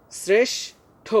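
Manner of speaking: শ্রেষ্ঠ drawn out, split before ঠ; slow practice model. Shown as a demonstration. Teacher invites pupils to practise, slow careful articulation. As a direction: say শ্রেষ্ঠ slowly for repeating, s then sh. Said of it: "তোমরা আমার সাথে practice করবে".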